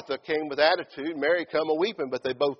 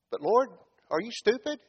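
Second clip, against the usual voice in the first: higher-pitched, doubting question; incredulous objection; 7 semitones higher than usual. Acted. Martha second-guessing the Lord, in incredulous protest. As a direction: question him as if he's lost his senses.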